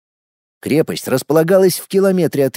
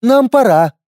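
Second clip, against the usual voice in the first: loud, high command; commanding shout; 9 semitones higher, 6 dB louder than usual. Acted. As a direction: bark it like a dragon queen. Dragoness ends the talk.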